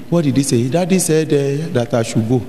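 ordinary delivery

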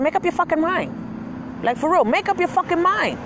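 high, slow exasperated telling-off; yelling at an indecisive customer; furious rant. Retold or imitated. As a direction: let the frustration boil over. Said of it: "I was like".

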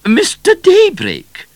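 very high, loud, drawn-out regal call; queen calling from the top of the stairs. A narrator's reading. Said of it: "the Queen turned and called".

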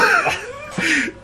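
shrieking burst of laughter. Real, not acted. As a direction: cackle at the payoff. Prank payoff.

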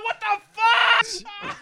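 loud, high, very slow outraged cry; shocked pained yell. Real, not acted. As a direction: drag it out in pain and shock. Right after the rip.

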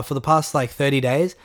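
ordinary delivery

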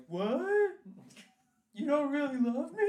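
high, hurt and baffled; whiny betrayed boyfriend. Pretend. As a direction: whine it like a dumped boyfriend. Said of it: "Austin be like".

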